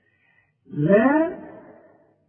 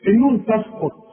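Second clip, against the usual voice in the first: ordinary delivery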